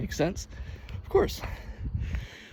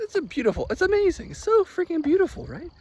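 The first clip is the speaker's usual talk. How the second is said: high, delighted gushing over the view. Real, not acted. Vlogger gushing to viewers about the view, high and delighted. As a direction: rave about how beautiful it is.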